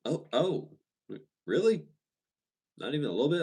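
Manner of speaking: high, taken-aback salesman voice; imitating surprise. Car salesman caught off guard by the answer, mock surprise. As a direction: play the stunned salesman, pitch up. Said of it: "he just kind of was like".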